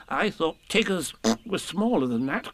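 hesitant storybook piglet. A narrator's reading. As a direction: voice doubt as a timid little animal. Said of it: "said Piglet".

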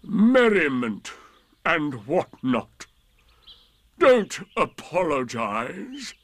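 slow, drawn-out gloomy sarcasm; bitter. A narrator's reading. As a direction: labour each phrase with weary resentment. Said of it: "said Eeyore bitterly".

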